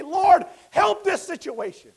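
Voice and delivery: slow, loud, high shouted plea; crying-out prayer. Acted. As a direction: shout it slowly up to heaven. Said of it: "crying out to the Lord, say".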